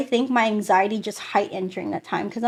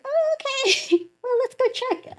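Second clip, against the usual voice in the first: very high, slowed, uneasy squeak; mock a rattled doctor backing off politely; imitating scared doctor; 14 semitones higher than usual. Doctor startled by patient, changing subject, high mock-scared. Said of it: "I think she got scared".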